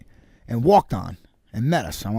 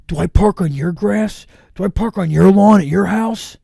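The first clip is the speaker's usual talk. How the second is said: high, louder indignant rant; mock angry ranger; 7 semitones higher, 6 dB louder than usual. Retelling the ranger scolding his buddy, higher and louder. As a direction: lecture him like a homeowner. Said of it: "And my buddy's like".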